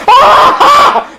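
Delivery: very high, loud mock bawl; imitated infant screaming; baby wail. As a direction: shriek like a puppet baby. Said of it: "the baby's going".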